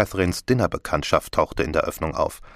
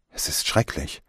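hushed, whispered horror; shuddering; 3 semitones lower, 4 dB quieter than usual. A narrator's reading. Catherine hears of the strangling, shuddering whisper. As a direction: breathe it out under the shock. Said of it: "Catherine schauderte".

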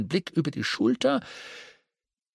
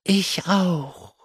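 higher, louder ladybug call; 6 semitones higher, 3 dB louder than usual. Acted. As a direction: ladybug crying out eagerly. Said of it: "rief der Marienkäfer".

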